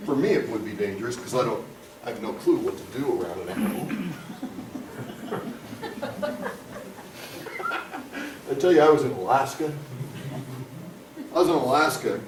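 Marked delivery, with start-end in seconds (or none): 3.9-8.4 soft breathy chuckle at himself; a light self-amused laugh; quiet laugh. Real, not acted. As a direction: laugh under your breath. Laughs after own joke.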